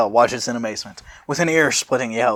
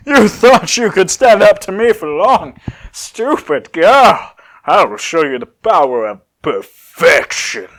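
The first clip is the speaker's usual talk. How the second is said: loud gloating villain; loud villain taunting the girl; menacing Cell; 7 semitones higher, 7 dB louder, slower than usual. Acted. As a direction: sneer it down at her loudly. Cell threatening in the story.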